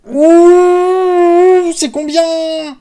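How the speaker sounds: very high, loud whoop held long; whoop, then stretch the question out high; excited whoop. Real, not acted. Reacting to a big slot hit. (cri aigu d'excitation)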